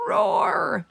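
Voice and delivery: a high, loud little roar that comes out a squeak; squeaky roar. Acted. Hamster roaring at Joey like a lion, high squeak. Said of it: "it sounded like a great big squeak".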